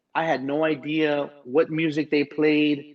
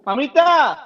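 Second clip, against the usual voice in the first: calling the dog; 11 semitones higher, 5 dB louder than usual. Real, not acted.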